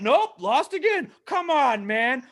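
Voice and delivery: high, loud exasperated letdown; hammed-up dashed hopes; mock frustration. Fan seeing his team lose again, mock frustrated. Acts out his repeated disappointment.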